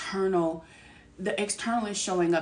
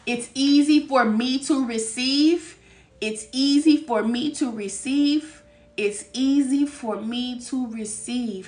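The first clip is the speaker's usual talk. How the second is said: slow, drawn-out affirmation with EASY stretched; 3 semitones higher, 5 dB louder, slower than usual. Shown as a demonstration. Reading the card's affirmation to viewers, slow and savoring. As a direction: savor each word of "easy for me". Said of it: "That's what the card says".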